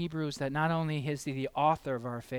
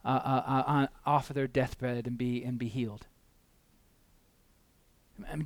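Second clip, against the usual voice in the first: normal talking voice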